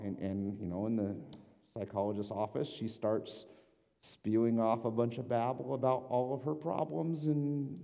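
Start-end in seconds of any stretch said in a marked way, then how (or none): none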